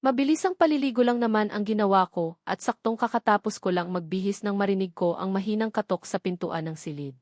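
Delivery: ordinary delivery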